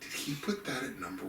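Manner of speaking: quiet, wondering voice; hushed disbelief. Retold or imitated. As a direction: murmur it in disbelief. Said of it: "I was like".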